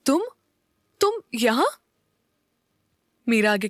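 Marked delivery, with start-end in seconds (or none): 0.1-1.7 halting, higher-pitched astonished question; surprised. A narrator's reading. Meera finds Neel behind her door, surprised. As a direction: pause on the first word, then rise in disbelief. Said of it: "Meera हैरान होकर बोली".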